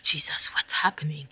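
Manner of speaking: whispered, retold plea to Jesus; hushed, fearful whisper; frightened whisper. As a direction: whisper it as if scared. Praying in fear, retold.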